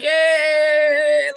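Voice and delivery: long, high, loud mock cheer; hammed-up "yay" held out loud; imitated happy cheer. Imitating always-happy Jamaicans on TV, over-cheerful cheer. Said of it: "Y'all always like".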